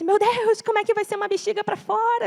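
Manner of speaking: high-pitched, dismayed question; retold desperation. Parents learning of baby's bladder surgery, retold desperation. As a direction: pitch it up in mock alarm. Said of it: "A gente ficou desesperado".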